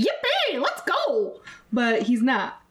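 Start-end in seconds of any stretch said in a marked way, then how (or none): 0.0-1.4 squeaky high jig-happy cheer; hammed-up leprechaun yelp of glee. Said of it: "that awful leprechaun, uh, voice".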